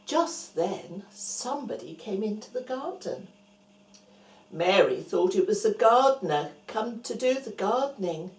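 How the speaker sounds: ordinary delivery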